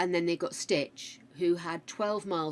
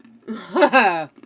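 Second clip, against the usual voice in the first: loud laugh; 4 semitones higher, 14 dB louder than usual. Real, not acted. Laughing loudly at a listener's question.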